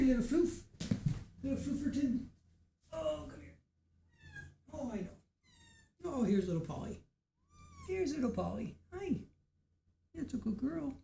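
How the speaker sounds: high, very soft coaxing. Real, not acted. Owner softly coaxing shy Polly, high hushed baby talk. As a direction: hush and coo to lure the cat.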